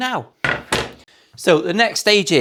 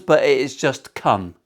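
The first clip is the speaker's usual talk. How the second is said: ordinary talk, nothing marked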